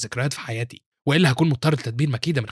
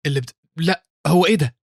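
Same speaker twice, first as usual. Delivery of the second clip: flustered stammer, higher and louder; 4 semitones higher, 4 dB louder than usual. A narrator's reading. Accused pupil protests to his master, flustered. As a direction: stumble into a startled protest.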